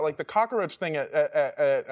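ordinary talk

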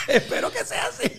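high-pitched, through laughter; laughing reply. Retold or imitated. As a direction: squeak it out while laughing. Bashful reply amid laughter.

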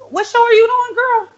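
very high, playful question; high squeal. Real, not acted. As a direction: squeal it to your friend. Host squeals question to friend.

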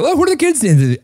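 high, curious voice of an out-of-touch adult; mock clueless parent. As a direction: ask like a puzzled grown-up. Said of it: "I'm like".

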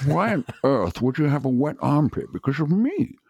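slushy Scottish s, voice lifted in question. Retold or imitated. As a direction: imitate the actor in baffled disbelief. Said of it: "I met [name] and [name]".

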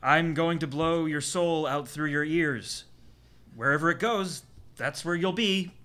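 slow, loud chanting medicine man; mystic medicine man. Acted. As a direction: intone like a mad shaman. Strange medicine man ritual.

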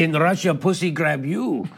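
put-on slow comic Russian accent. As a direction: deliver the punchline in a mock Russian accent. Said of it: "Are you allowed to do a Russian accent?".

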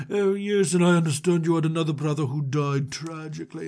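lightly affected host voice; mildly theatrical odd-host act; Mister Manager. Acted. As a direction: put on a slightly strange voice. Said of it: "his voice sounds a little different".

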